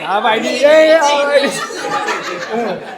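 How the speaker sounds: half laughing, half crying; laughing through tears. Real, not acted. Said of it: "I really can't talk when I cry".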